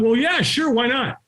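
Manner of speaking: high, carefree acceptance; breezy agreement. Retold or imitated. Hiding man accepting offer to just watch, breezy. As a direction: agree lightly, voice lifted.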